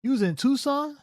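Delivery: high, rising disbelief; you were WHERE; incredulous question. Real, not acted. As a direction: throw the fact back at him. States fact then repeats incredulous.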